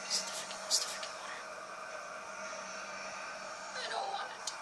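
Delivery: very soft whispered dread; fearful whisper. Real, not acted. Dreading water in horror game.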